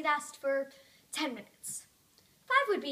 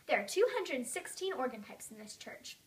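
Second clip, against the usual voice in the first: ordinary delivery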